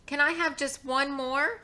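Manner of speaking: put-on high-pitched child's plea; wheedling storybook child; begging. A narrator's reading. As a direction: plead sweetly with mother, voice lifting. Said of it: "I begged".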